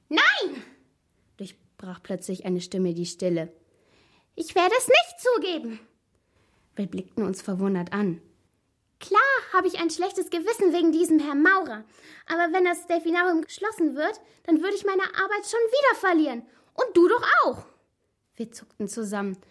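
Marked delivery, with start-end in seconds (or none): 0.1-0.6 high, squeaky outburst. A narrator's reading. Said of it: "Diese piepsige Stimme". 4.3-5.8 high, loud squeaky refusal. A narrator's reading. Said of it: "Diese piepsige Stimme". 9.0-17.6 high squeaky arguing, getting higher. A narrator's reading. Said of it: "Diese piepsige Stimme".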